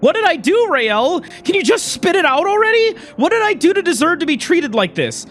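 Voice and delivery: high, upset, pleading argument; exasperated demand. Acted. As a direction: demand an answer in hurt frustration. Acted quarrel, demands an answer.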